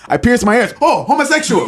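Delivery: laughing attempt at an African accent. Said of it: "I'm not good at my African accent".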